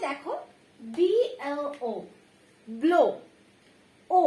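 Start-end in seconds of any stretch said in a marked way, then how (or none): 2.7-3.0 ব্লো ending in a round o; blo with o. Shown as a demonstration. Teacher showing the o ending in ব্লো. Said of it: "শেষে কিন্তু ও উচ্চারণ রয়েছে".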